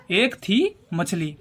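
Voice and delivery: एक थी drawn out, pause before मछली; slow, spaced out. Shown as a demonstration. Teacher drilling a memory trick, एक थी मछली slowly spaced out. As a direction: stretch एक थी, then give मछली separately.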